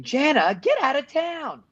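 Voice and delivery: a hint of a shouting boy; quoted line. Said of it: "Marco says it".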